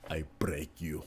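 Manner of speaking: soft, low movie-villain line; Drago impression. Streamer quoting Drago to chat, low villain voice. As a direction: say it quietly, like a menacing Drago. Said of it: "About Drago".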